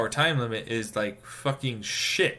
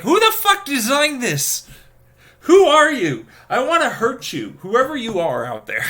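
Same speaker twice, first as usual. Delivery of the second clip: high, loud indignant demand; angry outburst; 12 semitones higher, 8 dB louder than usual. Acted. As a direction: lash out at an unknown culprit. Rants at unknown designer.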